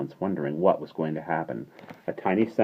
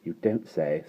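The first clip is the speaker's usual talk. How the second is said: sympathetic murmur; 5 dB quieter than usual. A narrator's reading. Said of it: "nodding her head sympathetically".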